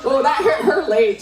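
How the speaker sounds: ordinary talk, nothing marked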